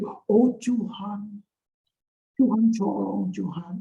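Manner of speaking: drawn-out, put-on pleading piety; changed prayer voice. Preacher imitating people whose voice changes when they pray, mock-pious. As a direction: stretch the words into a fake-holy plea. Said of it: "suaranya berubah".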